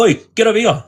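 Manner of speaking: high, sharp call across the prison wing. Retold or imitated.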